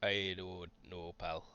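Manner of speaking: put-on lightly Scottish-accented leprechaun; a touch of leprechaun brogue, slow; Scotch leprechaun voice. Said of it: "weird Scotch leprechaun voices".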